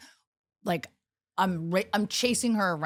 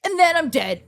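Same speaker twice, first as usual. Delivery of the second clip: put-on higher, louder, sing-song child; playful kid; 9 semitones higher, 6 dB louder than usual. Daughter jokes about dying, higher and playful. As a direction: say it cheerfully like a game.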